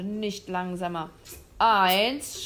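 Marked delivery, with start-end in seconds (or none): 1.6-2.4 a loud, yelled count; shouted. Acted. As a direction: yell the number out. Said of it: "schrie der Jäger".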